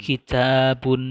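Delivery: "Kitabun" slow, loud on a long "ta"; stress on long vowel. Shown as a demonstration. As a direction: stretch and punch the "ta" in "Kitabun". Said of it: "harokat panjang harus diberi penekanan". (tekan dan panjangkan "ta" dalam "Kitabun")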